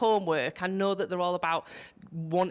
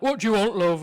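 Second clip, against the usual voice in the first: gruff guard call; 3 semitones higher, 8 dB louder than usual. Retold or imitated. Security guard at the barrier.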